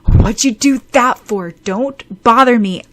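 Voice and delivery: loud, high annoyed outburst; irritated snapping. A narrator's reading. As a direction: snap crossly at an interrupting pest. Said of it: "He glared at her".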